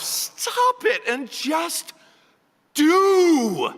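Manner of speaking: very slow, very loud and high, hammering each word; slow shouted command. Acted. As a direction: bellow the final order at her. Climax of the rant.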